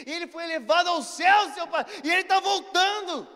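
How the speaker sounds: loud, high, climactic declaration; shouted proclamation. Retold or imitated. Friend telling the priest the book's ending, shouting. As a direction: build to a triumphant shout. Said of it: "de tanto que eu gritei ali".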